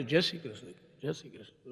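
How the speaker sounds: soft, low, solemn greeting; hushed condolence greeting. Retold or imitated. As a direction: mumble it respectfully at a mourning house. Folded hands greeting at funeral. (ધીમા અવાજે શોકમાં નમસ્કાર)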